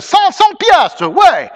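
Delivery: high, loud, drawn-out French cry; cried out. A narrator's reading. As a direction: call it out slowly to a rowdy crowd. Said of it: "cried a listener".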